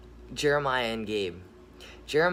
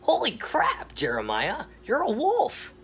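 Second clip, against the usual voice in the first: high, loud astonished squeal; surprised gator voice; 11 semitones higher, 3 dB louder than usual. Acted. Gator shocked to see Jeremiah turned wolf, high squeal. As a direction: gasp it out in a high cartoon voice. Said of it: "Nathan the gator looks over at Jeremiah and says".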